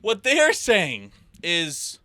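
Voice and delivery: high, slow disbelief; spelling out something absurd; incredulous. Real, not acted. Mocking video's message.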